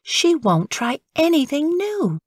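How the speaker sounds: mother fretting to father; gently voiced mother. Mother telling father about picky Frances, lightly voiced.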